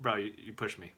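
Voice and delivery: low, soft, unruffled retort; calm. Retold or imitated. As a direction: say it quietly, keeping your cool. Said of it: "calmly, like, this is my voice".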